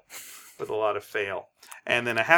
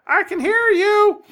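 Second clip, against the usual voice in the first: pitched-up mocking parrot, drawn out; high nerdy parrot voice; 8 dB louder, slower than usual. Parrot mimicking the captain in a high squawk. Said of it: "says the nerd".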